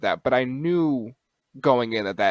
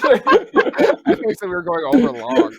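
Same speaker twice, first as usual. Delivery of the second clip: loud, high, laughing through the words; helpless high-pitched laughter while talking; 8 dB louder, slower than usual. Real, not acted. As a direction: crack up while teasing your co-host. Joking over cutting podcast short.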